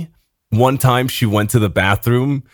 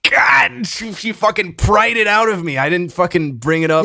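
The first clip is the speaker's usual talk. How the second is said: high-pitched, indignant defence; exasperated protest; 8 semitones higher than usual. Real, not acted. Exasperated defence after being teased.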